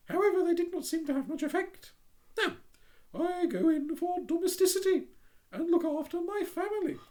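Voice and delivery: prim little duck voice, high. Acted.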